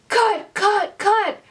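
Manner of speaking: high, loud urgent cries of cut; retold scream of cut. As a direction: shout cut like a panicked director. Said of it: "you hear her scream".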